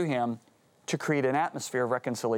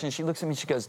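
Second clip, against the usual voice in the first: ordinary delivery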